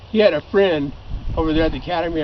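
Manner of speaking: normal talking voice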